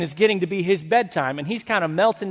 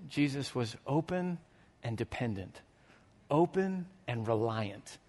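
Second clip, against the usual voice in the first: softer, slow and deliberate; quiet emphasis; 6 semitones lower, 11 dB quieter than usual. Real, not acted. Preacher contrasting Jesus with cautious churchgoers, hushed and slow. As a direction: drop the voice to land it.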